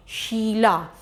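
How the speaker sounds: शीला with a slow, long ई; teacher saying शीला slowly to show the long vowel; drawn-out Sheela. Shown as a demonstration. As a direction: linger on the ई of शीला. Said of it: "खींच रही है".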